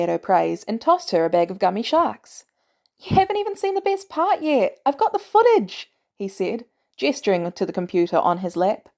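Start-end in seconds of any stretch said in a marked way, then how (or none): 3.0-5.9 put-on much higher, excited kid voice; eager young Peter. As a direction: bounce up high like a proud teenager. Peter eagerly shows off footage.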